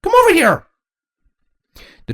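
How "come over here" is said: very high, loud call; high-pitched princess yell; shout. A narrator's reading. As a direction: call out to the dragon. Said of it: "Twilight shouted to the dragon".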